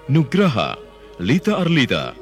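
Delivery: ordinary talk, nothing marked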